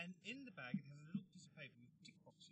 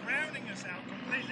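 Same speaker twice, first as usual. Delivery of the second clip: raised voice over helicopter noise; shouting over noise; 8 semitones higher, 14 dB louder than usual. Real, not acted. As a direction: talk loudly over the rotor roar. Helicopters passing overhead.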